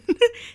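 short, high-pitched laugh; amused laugh. Real, not acted. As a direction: burst out in a quick high giggle. Laughs at the retold answer.

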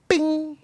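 high, ringing "bing"; imitated phone ping. Imitating the group chat on his phone, high ping. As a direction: sing out a notification chime.

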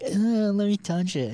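high, slow breathy-free mock woman voice; mock eager woman. Friend retelling party women, high mock voice. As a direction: pitch it way up, drawn out.

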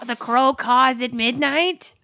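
slow, theatrical password voice, hammed up; mock dramatic spy line. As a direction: intone it like a cloak-and-dagger code. Mock secret code phrase.

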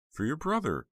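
very high, loud protest; crying out. A narrator's reading. Heather reminds Snowman of his brother, crying out. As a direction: blurt it out high and distressed. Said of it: "Heather cried".

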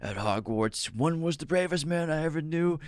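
slow, faint last words; like I'm dying. Acted. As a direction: speak as if drawing a final breath. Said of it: "why am I talking like I'm dying".

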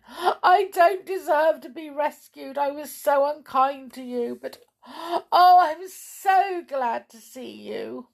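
high, tearful relieved gushing. Acted. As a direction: weep with relief and shame. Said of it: "sobbed Amelia Jane".

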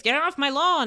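hammed-up cranky neighbour; mock grumpy shout. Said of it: "is going out and shouting".

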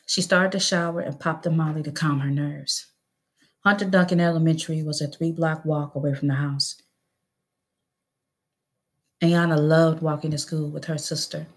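ordinary delivery

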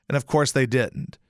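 usual speaking voice

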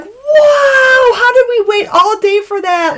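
laughing giddy exclaim; laughing shout. Retold or imitated. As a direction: gush with thrilled disbelief. Retold reaction after the ride.

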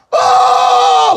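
high yelled name call; retold loud scream. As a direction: shout his name across the venue. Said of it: "he screams my name so loud".

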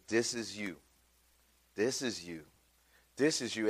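low, soft, solemn repetition; quiet conviction. Acted. God showing a man himself at every door, quiet and solemn. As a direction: let each one sink in gently.

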